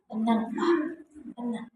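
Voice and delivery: soft, hushed calming; calm him down gently; soothing. Acted. Wife soothing anxious husband, soft and calm. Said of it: "Ucapannya itu menenangkan".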